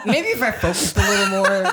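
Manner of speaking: real sheepish laugh. Real, not acted. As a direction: laugh through an embarrassed admission. Admitting she got lost.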